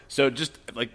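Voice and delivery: ordinary talk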